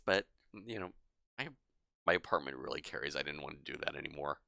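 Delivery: usual speaking voice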